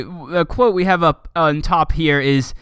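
ordinary talk

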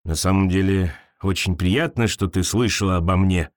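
low, glad creature voice; low creature voice delighted; pleased creature. A narrator's reading. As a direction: rumble it out happily. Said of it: "обрадовалась непиявка".

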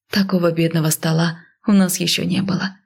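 plain reading voice

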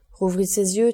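plain reading voice

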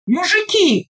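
high hoarse shout; hoarse yell. A narrator's reading. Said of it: "хрипло заорал всадник".

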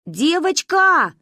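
slow loud high call. Acted. Girl calls out to the stranger, slow and loud. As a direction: call across the street. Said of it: "позвала я".

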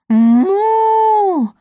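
long, high, loud moo; booming cow moo. A narrator's reading. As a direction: moo for the whole neighbourhood to hear. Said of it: "раздалось на всю округу". (громкое протяжное мычание)

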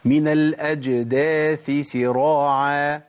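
"الأجداث" with a clear ث before "سراعا" with a clear س; slow careful recitation. Shown as a demonstration. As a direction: separate the ث of "الأجداث" from the س of "سراعا", slowly. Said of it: "أخد بالي". (تلاوة بطيئة تفصل الثاء عن السين)

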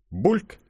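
short, high-pitched "bloop"; bubble plop. A narrator's reading. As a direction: imitate a bubble popping up. Звук пузыря из болота. (высокий короткий «бульк»)

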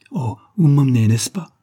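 loud, high, mildly tearful plea. A narrator's reading. Tearful daughter begging her mother, mildly tearful plea. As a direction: plead to be taken along, lightly tearful. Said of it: "fit la pauvre jeune fille tout en larmes".

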